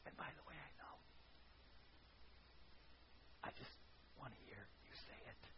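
unvoiced intimate whisper as God. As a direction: whisper it close, tenderly.

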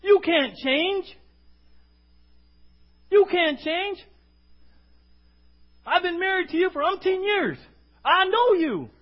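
high, loud put-down; exasperated spouse, loud and insistent; nagging spouse. Acted. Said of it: "The person sitting right next to you".

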